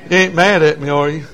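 ordinary talk

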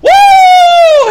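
loud, very high, long "woo"; imitating an ecstatic worshiper's holler; shouted whoop. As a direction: let out a piercing revival whoop. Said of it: "he was shouting, he was going".

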